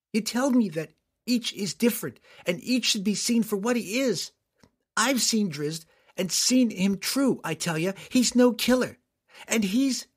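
a daughter standing firm, voice lifted high; earnest young girl. Acted. Girl defends Drizzt.

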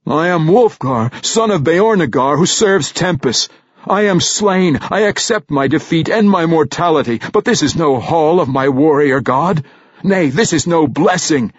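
a warrior refusing this heaven; defiant declaration. A narrator's reading. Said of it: "He spat the last sentence".

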